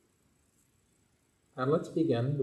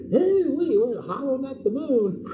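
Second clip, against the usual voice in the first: high excited puppy voice, much higher and louder; rascal puppet dog; 12 semitones higher, 8 dB louder, slower than usual. Acted. Puppet dog Barkley tells the children his mix-up in a high, excited voice. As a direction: play the goofy rascal dog.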